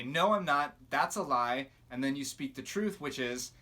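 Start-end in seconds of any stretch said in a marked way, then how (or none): 0.0-1.7 louder, emphatic refusal; firm rebuke. Shown as a demonstration. Teaching listeners to answer the accusing voice, loud and firm. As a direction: call the lie out with force. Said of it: "You say".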